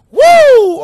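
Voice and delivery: high, loud "woo"; loud whoop. Real, not acted. Host recovering from his laughing fit, loud whoop.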